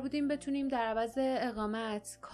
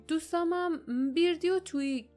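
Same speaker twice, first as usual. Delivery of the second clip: nervous stammer; 7 semitones higher, 3 dB louder than usual. Acted. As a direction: make up the names on the spot, flustered. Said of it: "باردا با لکنت گفت". ("بیردی و تویگ" با دستپاچگی و صدای بالاتر)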